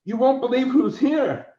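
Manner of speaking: high, loud excited news; breathless news. Retold or imitated. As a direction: blurt it out with wide eyes. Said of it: "He goes".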